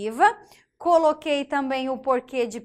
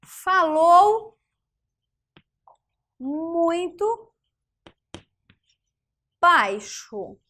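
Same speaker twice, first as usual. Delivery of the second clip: slow dictation; 3 semitones higher, 4 dB louder, much slower than usual. Shown as a demonstration. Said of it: "elaborar uma outra questão".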